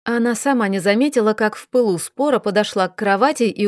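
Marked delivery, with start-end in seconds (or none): none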